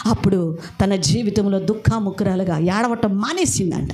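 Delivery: ordinary talk, nothing marked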